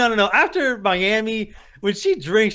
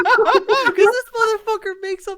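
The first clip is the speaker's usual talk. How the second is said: words squeezed out through high laughter; high giggling through the words; laughing; 11 semitones higher, slower than usual. Real, not acted. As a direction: laugh hard, barely getting it out. Laughing while talking.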